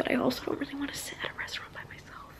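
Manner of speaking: fast, sheepish whisper. Real, not acted. Vlogger confessing her fear to viewers in a whisper. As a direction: whisper it like a secret admission.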